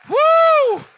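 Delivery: high, loud joyful whoop; exultant whoop. Real, not acted. Pastor celebrating with the congregation, joyful whoop. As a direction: let out a victory whoop.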